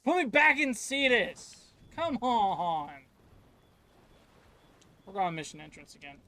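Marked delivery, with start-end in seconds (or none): 0.0-2.9 higher, louder exasperated plea; frustrated shout. Real, not acted. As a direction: demand it back, fed up. Frustrated complaint at the game.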